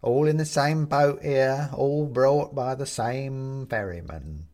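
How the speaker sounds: slow, mock-friendly bony drawl; skeleton voice. A narrator's reading. Skeleton voice continues.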